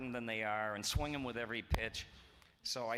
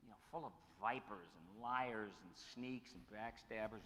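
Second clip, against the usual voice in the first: wife's quiet words; 10 dB quieter than usual. Retold or imitated. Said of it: "she was saying".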